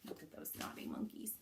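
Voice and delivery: quick, hushed whisper; soft, breathy storyteller aside. Real, not acted. Aside to children about the monkeys.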